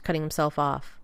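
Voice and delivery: plain reading voice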